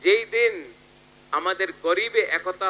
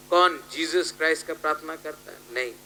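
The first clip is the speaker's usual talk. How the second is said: normal talking voice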